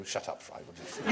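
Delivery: hushed, dismissive whisper; mock teacher. Teacher shutting the schoolboy up, whispered. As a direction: brush it off under your breath. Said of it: "We go".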